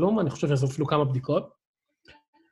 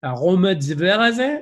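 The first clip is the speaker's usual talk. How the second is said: put-on French accent in Hebrew; 4 semitones higher, 5 dB louder, slower than usual. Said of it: "את שניהם צריך להגיד במבטא צרפתי כבד".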